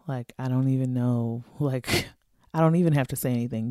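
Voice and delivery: usual speaking voice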